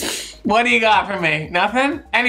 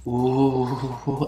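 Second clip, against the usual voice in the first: low, soft knowing ooh; 6 semitones lower, 4 dB quieter than usual. Retold or imitated. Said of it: "[name] goes like this".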